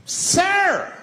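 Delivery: high, outraged mock yell; sudden high-pitched cry of protest; freaked-out passenger. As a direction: yelp it out like an appalled bystander. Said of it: "And people freaked out".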